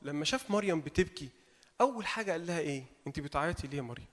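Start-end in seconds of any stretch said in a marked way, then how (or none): 3.1-4.1 soft, low, tender question; gently comforting. Acted. Jesus comforting weeping Mary, soft and tender. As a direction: soothe her like a loving father. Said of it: "وكانه جاي يطبطب عليها في الاول".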